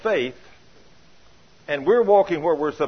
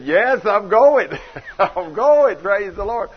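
emphatic loud reply; 4 semitones higher, 7 dB louder, slower than usual. Retold or imitated. Answering emphatically, slow and loud.

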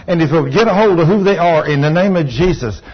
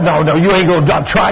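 ordinary talk

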